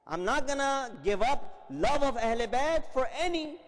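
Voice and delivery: loud and slow, every word weighed; fervent refusal. Retold or imitated. Man refusing Imam's deal, loud slow fervent refusal. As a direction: refuse it with full conviction.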